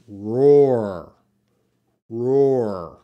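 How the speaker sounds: "Roar" drawn out with a strong R at each end; slow pronunciation demo. Shown as a demonstration. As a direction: ROAR stretched out, both R's fully sounded. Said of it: "how to pronounce roar".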